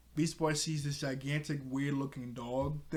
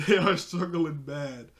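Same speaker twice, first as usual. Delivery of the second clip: slow, louder words through a laugh; 2 semitones higher, 5 dB louder, slower than usual. Real, not acted. Storyteller mocking those struggling with the gigantic cups, laughing. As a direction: drag it out while cracking up.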